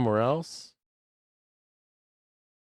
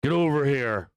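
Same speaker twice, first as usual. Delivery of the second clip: alpha command; 3 semitones higher, 3 dB louder than usual. Shown as a demonstration. Owner shows how he handles his alpha dog, commanding. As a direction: play the boss summoning a dog. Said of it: "he's the alpha, so I gotta be like".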